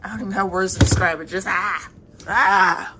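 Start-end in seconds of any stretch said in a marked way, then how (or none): 1.3-2.9 loud, drawn-out fake groan; dramatic groan. Shown as a demonstration. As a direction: groan like it's all too much. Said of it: "dramatic groan".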